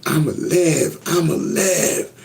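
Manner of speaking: high, drawn-out re-enacted cry; insistent, high-pitched retold declaration; going all out. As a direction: stretch it out high, desperate to be believed. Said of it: "So I was going O D".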